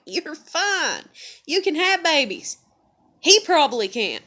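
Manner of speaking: the doctor's brush-off, swelling up loud; mock doctor. Doctor quoted, rising indignation.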